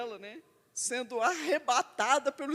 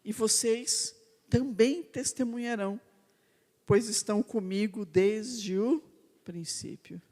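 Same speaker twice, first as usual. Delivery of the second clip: sermon reading; 4 semitones lower than usual. Acted. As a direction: lay each phrase down gravely to the congregation. Slow preaching, scripture and exhortation.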